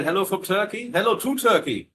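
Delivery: ordinary delivery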